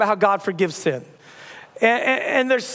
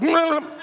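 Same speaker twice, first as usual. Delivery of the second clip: high mumbled hum over her words; mock not-listening noise, pitched up; drowning someone out; 8 semitones higher than usual. Pretends not to hear the doctor.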